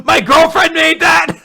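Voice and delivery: very loud, high-pitched indignant yell; upset mock shout. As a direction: shout it all in one run, outraged. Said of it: "like, very upset".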